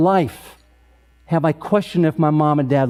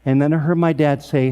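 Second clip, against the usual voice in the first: ordinary delivery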